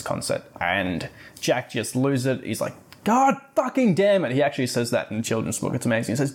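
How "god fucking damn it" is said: mock outburst. Jack storms off at the party with a hammed-up curse. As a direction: blurt it like a furious kid losing it.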